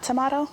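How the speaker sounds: "tomato" said to-MAH-to; broad-A pronunciation. Shown as a demonstration. Chef echoing the helper's question, "tomato" as to-MAH-to. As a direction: echo "tomato" with a broad AH vowel. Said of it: "why do I say tomato and not tomato".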